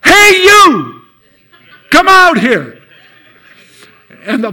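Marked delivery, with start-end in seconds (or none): 0.0-2.7 very high, loud shout; yelled call. Retold or imitated. Preacher yelling at the house, loud shout. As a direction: holler at the house to come out. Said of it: "and yelled".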